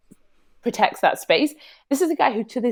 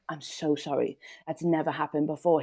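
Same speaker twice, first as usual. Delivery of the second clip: soft, low mock-apologetic voice; tearful apology; 4 semitones lower, 8 dB quieter than usual. Man apologising to her afterwards, lightly imitated as tearful. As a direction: quietly imitate a contrite man close to tears. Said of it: "nigh in tears".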